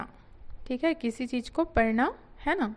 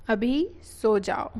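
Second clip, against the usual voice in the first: slow model phrase; slower than usual. Shown as a demonstration. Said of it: "teacher models the phrase".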